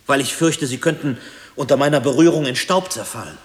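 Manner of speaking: high, increasingly loud anxious confession; mounting dread. Acted. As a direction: let the fear climb as you speak. Confesses dread of world crumbling.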